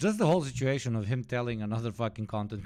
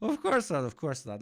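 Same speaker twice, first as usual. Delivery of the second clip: laughing scoff; 4 semitones higher, 5 dB louder, faster than usual. Real, not acted. Sarcastic laugh at the question.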